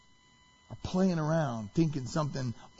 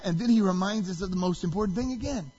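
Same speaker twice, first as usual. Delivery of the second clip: ordinary talk, nothing marked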